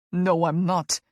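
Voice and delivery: usual speaking voice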